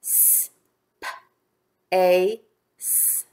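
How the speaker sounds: "S, P, A, S" as separate phonemes; hissed s, puffed p, long a, hissed s in "S, P, A, S"; tapping the sounds. Shown as a demonstration. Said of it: "Let's tap those sounds".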